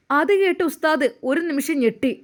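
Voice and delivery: usual speaking voice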